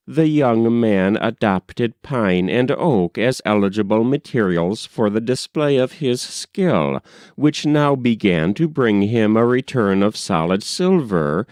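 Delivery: ordinary delivery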